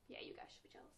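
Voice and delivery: unvoiced teasing whisper. Real, not acted. Vlogger whispering to viewers to be jealous. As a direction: whisper it as a secret.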